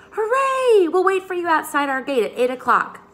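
high, loud joyful cheer; delighted cheer. A narrator's reading. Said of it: "clapped their hands with delight".

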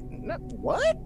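high, soft baffled squeak; baffled disbelief. Retold or imitated. Answering coworkers who noticed the accent, mock baffled disbelief. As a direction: go up high and small, taken aback.

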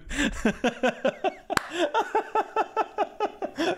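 long, high giggling laugh. Real, not acted. Streamer still laughing at his Facebook Live line. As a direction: keep laughing helplessly.

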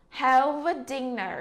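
ordinary talk

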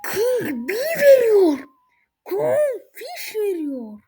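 high, loud, slow crying out; excited shriek. Real, not acted. Streamer yelling to viewers about a huge offer, excited shriek. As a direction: shriek the names in thrilled shock. Shocked by a big trade offer.

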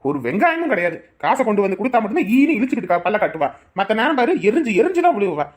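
loud, swooping exasperated rant; angry tirade. Acted. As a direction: vent frustration with heavy punched words. Husband storms at wife in quarrel. (ஆத்திரத்தில் உரத்த குரலில் திட்டி)

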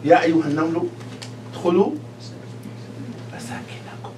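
ant's call sinking to a whisper; talking ant. Acted. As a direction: voice the ant's warning, dropping into a whisper at the end. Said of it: "how the نملة is talking?".

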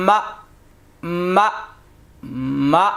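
মা cut short with a light glottal catch; slight push. Shown as a demonstration. Teacher drilling mim hamza zabar, slight push on the stop. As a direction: give each মা a small jolt at the end. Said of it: "হালকা একটু ধাক্কা হবে".

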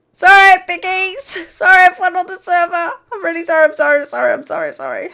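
high, loud shouted sorry, fading down; shouted apology. Real, not acted. Fleeing the pigmen, shouting sorry to the whole server. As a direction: yell sorry over your shoulder while running.

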